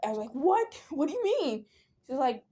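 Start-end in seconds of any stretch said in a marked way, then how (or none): 0.3-1.7 shocked what. Retold or imitated. Told she'll inform parents.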